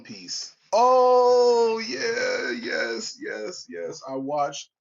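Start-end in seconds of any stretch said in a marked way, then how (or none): 0.7-4.0 high excited outburst. Real, not acted. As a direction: burst out loud and high with glee. Excited reaction to One Piece.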